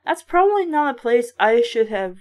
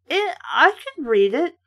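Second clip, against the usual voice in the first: plain reading voice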